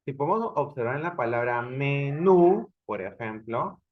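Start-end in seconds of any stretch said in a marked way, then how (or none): 1.6-2.7 "menú" with the NÚ louder and drawn out; stress on final syllable. Shown as a demonstration. Teacher showing students the strong syllable, stressed "menú". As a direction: lean long and hard on the last syllable of "menú". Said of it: "hay una intensidad mayor de voz".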